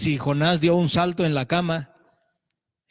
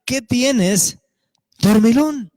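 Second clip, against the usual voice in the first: loud, sharp wake-up call; a captain barking at a sleeping man; rousing shout; 6 semitones higher, 6 dB louder, much slower than usual. Acted. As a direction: shake a sleeper awake with your voice. Preacher voices ship captain waking Jonah.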